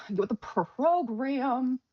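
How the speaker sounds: slow, stretched mock scolding; drawn-out self-chiding. Real, not acted. Streamer chiding self for forgetting a setting, drawn-out mock scolding. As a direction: stretch out "program" with a lingering r.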